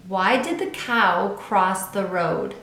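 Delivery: ordinary delivery, nothing marked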